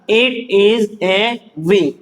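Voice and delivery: very slow, word by word; drawn-out words, evenly spaced; slow reading drill. Shown as a demonstration. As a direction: model the sentence for beginners, slowly. Teacher models sentence to learners.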